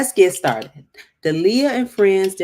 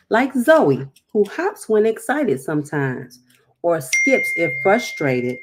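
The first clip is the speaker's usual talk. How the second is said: bright, louder storybook read-aloud; reading to children; 3 semitones higher, 6 dB louder than usual. Acted. Teacher reading a picture book to the class, lively and clear. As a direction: read it out like a teacher to little kids.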